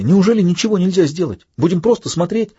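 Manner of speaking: breathless high pleading voice; gasping, desperate questions; panting shaky. A narrator's reading. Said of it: "задыхаясь, проговорил Матья".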